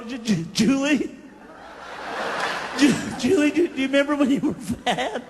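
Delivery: stammering while holding in a laugh; trying not to laugh at Julie; stifled laugh. Retold or imitated. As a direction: fight back giggles as you ask. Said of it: "He'll go".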